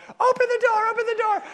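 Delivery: high, loud panicked demand; frantic mom. Retold or imitated. Cabin leader banging on the locked door, frantic shout.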